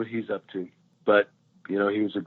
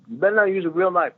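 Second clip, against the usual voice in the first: high, fast Eddie impression; a jokey warning in a high voice; imitating Eddie; 8 semitones higher, 4 dB louder, faster than usual. Eddie Murphy warning the crew, high and fast. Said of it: "he was like".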